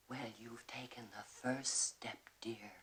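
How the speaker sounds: put-on whispered, kindly old lady, soft; imitating a kind lady, whispered; sweet lady's whisper. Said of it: "The one lady was nice".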